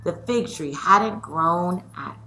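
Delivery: lively read-aloud storytelling; story reading. A narrator's reading. Teacher reading a picture book aloud to her scholars, lively.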